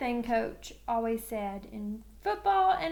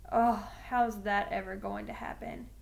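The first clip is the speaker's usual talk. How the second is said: ordinary delivery, nothing marked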